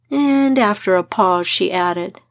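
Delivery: usual speaking voice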